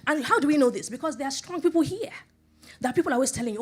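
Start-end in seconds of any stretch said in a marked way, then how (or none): 0.1-2.1 rising preacher fervor. Real, not acted.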